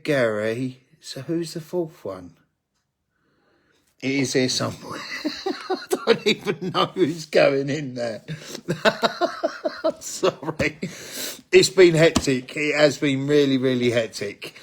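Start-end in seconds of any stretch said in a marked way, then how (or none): 4.0-10.8 laughing. Real, not acted. Laughs at forgetting names.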